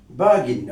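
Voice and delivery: বার্গেন the British way, "BAH-gin", silent r; British pronunciation. Shown as a demonstration. Teacher showing what British speakers say, British pronunciation. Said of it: "British স্পিকারকে বলতে শুনবেন".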